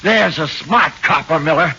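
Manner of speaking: loud, high mocking jab; loud sneer. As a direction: taunt the cop loudly. Suspect mocks cop loudly.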